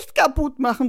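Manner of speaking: put-on high, loud child's plea; little boy calling in alarm. A narrator's reading. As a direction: cry out to stop someone. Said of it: "ruft Florian".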